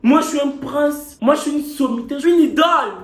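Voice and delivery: vantard. Acted. Fanfaronnade, proclame être un prince.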